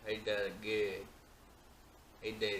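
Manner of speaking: a light mock Southern accent. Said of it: "Larry the Cable Guy".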